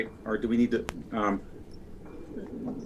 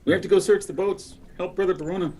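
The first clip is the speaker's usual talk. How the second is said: put-on Irish accent act; 6 semitones higher than usual. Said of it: "you don't have to do the act".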